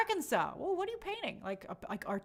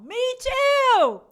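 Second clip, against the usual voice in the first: excited shout; 13 semitones higher, 13 dB louder than usual. Real, not acted. Excited reply to viewer.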